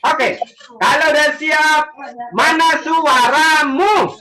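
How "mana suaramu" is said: usual speaking voice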